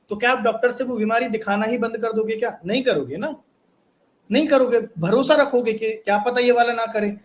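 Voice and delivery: usual speaking voice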